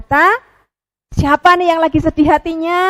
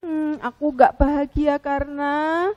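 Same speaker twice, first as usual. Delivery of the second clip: sad penguin; 2 semitones lower, 7 dB quieter than usual. Acted. Penguin begins to explain sadly.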